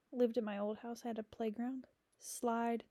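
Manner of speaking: normal talking voice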